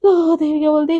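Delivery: plain reading voice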